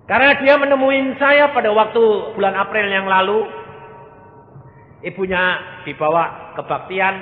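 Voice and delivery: loud, high preacher's cry; fiery preaching. Acted. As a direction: proclaim it to the hall. Preaching at full force. (khotbah lantang)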